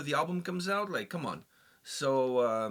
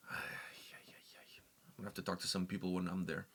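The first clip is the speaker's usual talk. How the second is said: hushed conspiratorial whisper; 7 semitones lower, 10 dB quieter, slower than usual. Real, not acted. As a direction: drop to a secret whisper, slowly. Conspiratorial aside about bookers.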